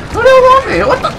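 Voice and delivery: high-pitched, baffled outburst; alarmed disbelief. Real, not acted. Player jumped by strangers in game, high alarmed disbelief. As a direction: voice jumps way up in bafflement.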